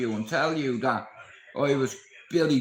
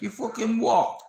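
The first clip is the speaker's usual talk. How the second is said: cocky challenge; 8 semitones higher than usual. Retold or imitated. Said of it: "And I'm like".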